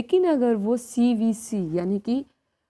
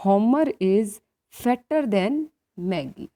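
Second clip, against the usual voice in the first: 1 slow example read; 2 semitones higher, 6 dB louder, much slower than usual. Shown as a demonstration. Teacher reads example sentence aloud.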